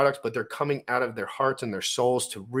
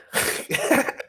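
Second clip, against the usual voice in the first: a short breathy burst of laughter, much higher; high airy laugh. Real, not acted. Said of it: "No, I'm just joking".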